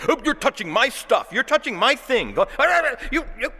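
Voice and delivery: high, whiny mock protest over stuff; mock possessive fuss. Preacher mocking a congregant guarding his things, whiny. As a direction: play the petty owner squealing. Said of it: "So you say".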